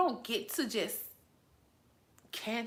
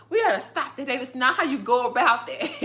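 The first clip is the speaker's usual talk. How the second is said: loud exasperated protest; 3 semitones higher, 6 dB louder than usual. Real, not acted. Reacting to baby dunking talk.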